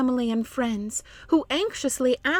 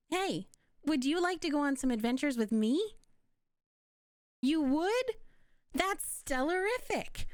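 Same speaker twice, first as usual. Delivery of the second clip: put-on bright, eager kids' show host; bubbly host voice; 4 semitones higher, 5 dB quieter than usual. Kids' host inviting young listeners on an adventure, eager. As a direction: invite the kids along with a grin.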